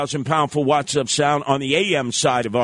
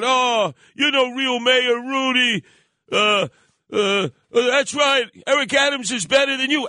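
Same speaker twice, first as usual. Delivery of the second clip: hammed-up taunt from a heckler in the parade crowd; mocking heckler; 8 semitones higher than usual. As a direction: needle him like a sneering heckler from the crowd. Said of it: "talking about".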